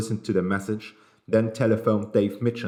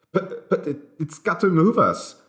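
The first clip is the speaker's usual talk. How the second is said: panicked pleading; 8 semitones higher than usual. A narrator's reading.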